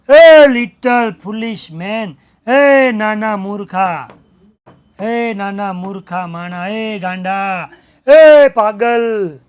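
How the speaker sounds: loud, slow furious scolding; furious insults. Retold or imitated. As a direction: spit each insult slowly and loudly. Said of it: "villain insults the priest".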